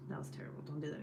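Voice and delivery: pure whisper, no voice at all. Real, not acted. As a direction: breathe the verdict as a secret aside. Repeats her verdict under her breath.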